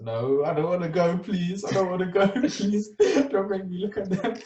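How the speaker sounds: high, begging voice with a laugh; pleading scared. Retold or imitated. Boy begging the imam not to make him look, scared. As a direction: whine please like a scared kid.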